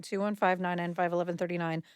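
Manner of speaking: fast, rattled-off phone number; quick repeat. Acted. Repeats number slowly then fast.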